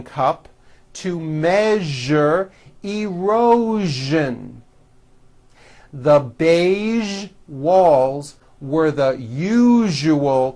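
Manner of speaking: slow model reading leaning on zh words; teacher reading drill sentences; practice sentence read. Shown as a demonstration. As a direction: read clearly for learners to copy. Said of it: "let's go over some sentences".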